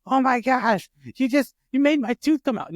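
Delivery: high-pitched mock child wail; crying upset child. Daughter blaming dad for her lost tooth, mock upset wail. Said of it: "like, crying upset".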